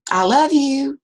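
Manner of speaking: high, loud warm call. Retold or imitated. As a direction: call it out to the hills. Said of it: "And he said".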